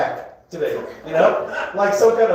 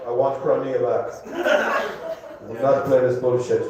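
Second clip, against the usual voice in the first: Boris Yeltsin; 6 semitones lower than usual. Acted. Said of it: "Boris Yeltsin's over there going".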